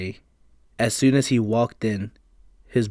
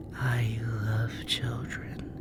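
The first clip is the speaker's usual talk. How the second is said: slow eerie whisper; creepy hungry spirit voice; raspy whisper; 8 dB quieter, much slower than usual. Acted. Spirit speaks.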